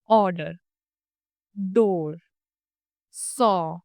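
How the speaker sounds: stretched rounded aw vowel; long rounded vowel. Shown as a demonstration. Teacher modelling the long back vowel for learners. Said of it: "थोड़ा सा ज्यादा stretch करके बोला जाता है".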